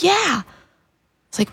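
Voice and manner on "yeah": much higher, naive yes; lightly voiced mom agreeing; a hint of mom's voice; mom's innocent yes. Mom confirms in quoted voice.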